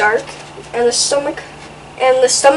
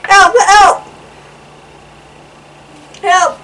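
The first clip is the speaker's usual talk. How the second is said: high, loud call for help; panicked cry for help; 7 semitones higher, 7 dB louder, slower than usual. Acted. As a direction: yell for help like a scared hippo. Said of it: "cried the hippo".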